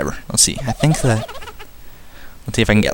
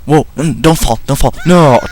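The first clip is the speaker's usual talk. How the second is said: raised, urgent plea into a loud no; alarmed outcry; 4 semitones higher, 4 dB louder than usual. Real, not acted. Gamer watching the hero about to fall, alarmed. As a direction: panic a little, then yell. Game character falling during play.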